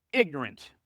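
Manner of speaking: usual speaking voice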